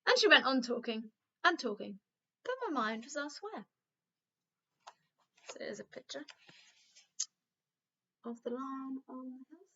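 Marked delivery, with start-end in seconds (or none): 5.5-9.7 softer, off-book aside to listeners; aside to class. Real, not acted. Teacher pausing the story to show a picture, quietly.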